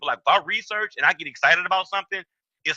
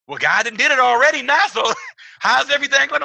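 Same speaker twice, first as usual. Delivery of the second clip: mock churchy woman; 10 semitones higher, 5 dB louder than usual. Woman voiced in the story.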